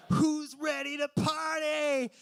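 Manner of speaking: very high, loud hammed-up party shout; party guy shout. Long-haired stranger arriving with soda, hammed-up party shout. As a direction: burst in yelling like a party animal. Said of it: "And he's like".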